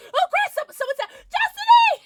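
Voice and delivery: very high, loud shriek; breaking into a squealed shout; excited screaming. Real, not acted. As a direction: scream it in delighted disbelief. Excited at a correct guess.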